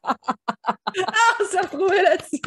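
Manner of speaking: real burst of laughter; laughing. Real, not acted. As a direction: crack up over the words.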